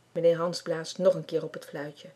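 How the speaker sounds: ordinary delivery, nothing marked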